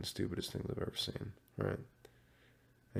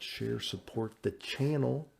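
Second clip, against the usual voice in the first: ordinary delivery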